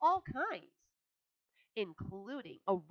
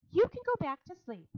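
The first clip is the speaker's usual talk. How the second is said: high, louder dismissal; Charlotte, pleased; 12 semitones higher, 8 dB louder than usual. A narrator's reading. Charlotte dismissing Wilbur, high and pleased. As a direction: release him happily.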